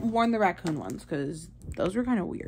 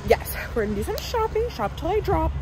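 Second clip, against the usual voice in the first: excited high voice; 8 semitones higher than usual. Real, not acted. Excited shopping announcement.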